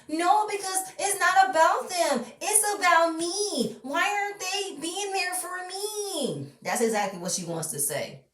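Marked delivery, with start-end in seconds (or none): 0.1-6.5 high, slow, whiny mock Portia; her tone. Mocking Portia demanding family be there, high and slow. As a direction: whine it, pitched way up and drawn out. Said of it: "In her tone".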